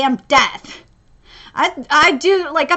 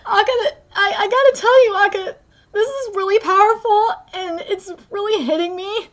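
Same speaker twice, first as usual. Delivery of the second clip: tearful, higher-pitched voice; real crying; 5 semitones higher than usual. Real, not acted. Reader tells the author how powerful this is, crying. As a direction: speak up through tears, deeply moved. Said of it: "it's really making me cry".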